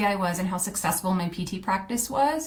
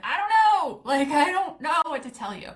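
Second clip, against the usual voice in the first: helpless shrug; 8 semitones higher than usual. Retold or imitated. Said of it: "And I was like".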